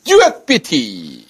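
high, loud infomercial pitch; hard sell ad. Acted. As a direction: sell it like a TV shopping host. Shopping host pitch.

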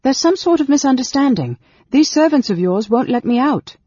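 high, loud, anxious protest; anger wavering into fear. A narrator's reading. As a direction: protest loudly, trying to keep calm. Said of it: "anger was starting to waver in fear's chill wind".

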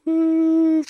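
high imitated woo of launch. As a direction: mimic a jet launching with a woo. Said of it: "they literally go".

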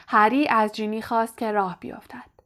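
usual speaking voice

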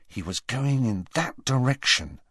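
usual speaking voice

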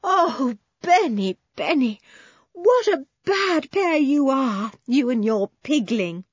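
high, overjoyed mother with a touch of tears; a hint of a tearful, relieved mother; mother crying over her boy. A narrator's reading. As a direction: scold him fondly through relief. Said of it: "She cried over him".

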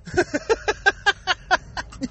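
a quick laugh breaking the rant; short laugh. Real, not acted. Mocking the president's schooling, a quick laugh. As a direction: laugh it off, amused at the insult.

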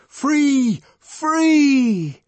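very high, loud exultant cry; wild liberating shout. A narrator's reading. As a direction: yell it out to the whole mob. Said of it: "the savage shouted".

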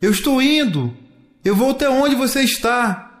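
high, eager answering call; child's high-pitched reply; calling back. A narrator's reading. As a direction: call up that you will follow. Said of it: "Adrien dizia".

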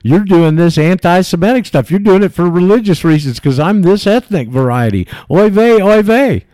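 hammed-up whiny impersonation rising to a shrill "oy vey"; high mock quote. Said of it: "he said".

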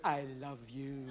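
low, slow, soft cat voice; smooth, drawn-out sweet talk; affectionate cat. Acted. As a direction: coo it slowly and very softly. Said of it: "he'll run up against your leg going".

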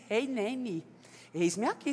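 softly voiced humble reply; quiet answer. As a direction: answer gently, bowing to the call. Ananias answering God's call.

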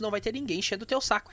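ordinary talk

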